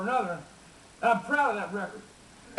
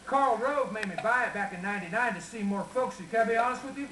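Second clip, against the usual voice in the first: folksy Texas twang; Texas accent; 3 semitones higher than usual. Acted. Folksy rancher telling family stories to a crowd, Texas accent.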